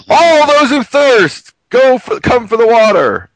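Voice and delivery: slow, high, loud proclaiming call. Retold or imitated. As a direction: stretch it out like a crier in the street. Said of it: "it's like".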